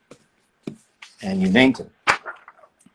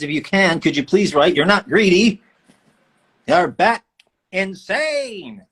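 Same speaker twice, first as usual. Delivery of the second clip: pitched-up, punched-out game character delivery; Raiden line; 7 semitones higher, 5 dB louder than usual. Acted. Reading out a fan's requested line, emphatic character voice. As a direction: build louder and higher, stretching the last word. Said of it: "my favorite riding line".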